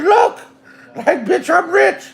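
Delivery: high, laughing boast of being rich; excited brag. Retold or imitated. Son boasting to his mother about money, high laughing brag.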